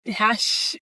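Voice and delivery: ordinary talk, nothing marked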